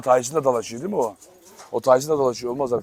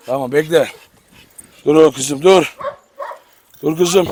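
usual speaking voice

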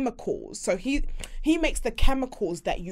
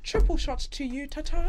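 pitched-up society-lady voice; hammed-up dainty toast; mock-genteel socialite; 6 semitones higher than usual. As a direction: put on airs while serving a drink. Pretend genteel bar toast.

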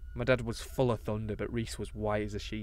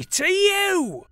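ordinary delivery, nothing marked

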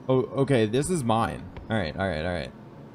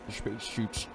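put-on Sean Connery accent; 2 semitones lower, 7 dB quieter, slower than usual. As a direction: space shoots with slushy sh. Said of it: "I'm Sean Connery".

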